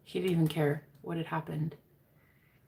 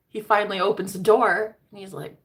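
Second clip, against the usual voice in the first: ordinary talk, nothing marked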